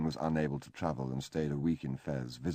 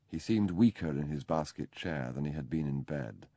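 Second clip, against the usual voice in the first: ordinary delivery, nothing marked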